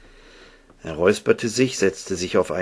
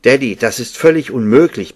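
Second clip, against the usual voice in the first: louder, higher objection; indignant protest; 4 semitones higher, 7 dB louder than usual. A narrator's reading. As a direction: push back indignantly against your father. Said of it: "begehrte Ben auf". (aufbegehrend, empört)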